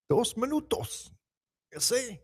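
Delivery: Scotty. Retold or imitated. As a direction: mimic a friend's voice, drawn out and high. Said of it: "I read that in your voice, Scotty".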